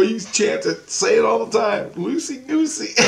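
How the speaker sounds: high, animated instruction; egging a kid on. Retold or imitated. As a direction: urge the child into a silly chant.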